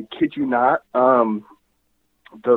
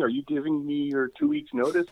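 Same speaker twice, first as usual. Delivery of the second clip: mock shock; 12 semitones higher, 4 dB quieter than usual. Teasing co-host about leaving.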